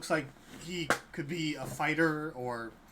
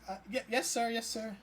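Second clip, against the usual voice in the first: nervous, higher-pitched stammered compliance; shaky nodding underling; 8 semitones higher than usual. Acted. Halfling answering an order to keep the place spotless, shakily nervous. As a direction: agree meekly, stumbling over the first word. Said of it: "He kinda just shakily nods and says".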